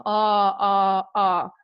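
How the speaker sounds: "ah" sounded out as separate open vowels; isolated AH vowels. Shown as a demonstration. Teacher showing assonance in an example, isolated "ah" vowels. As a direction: draw out the shared "ah" three times. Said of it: "That is assonance".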